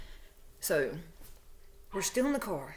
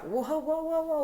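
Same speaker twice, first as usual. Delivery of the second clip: retold alarmed protest; 8 semitones higher than usual. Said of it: "I'm already reared up".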